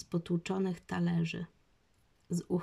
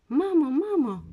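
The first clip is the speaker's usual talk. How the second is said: put-on loud, high child's cry for mum; alarmed high-pitched yell; shouting; 9 semitones higher, 10 dB louder than usual. A narrator's reading. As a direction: call out urgently for your mother. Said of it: "krzyknęła Basia".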